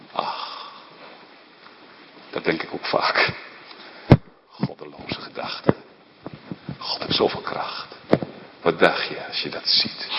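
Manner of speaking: hushed whisper, breath only. Acted. Preacher confiding doubts to the congregation, in a whisper. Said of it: "misschien fluisterend".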